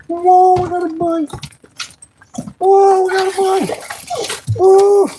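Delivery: real fishing excitement; excited. Real, not acted. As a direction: shout it out thrilled at the catch. Excited about catching a fish.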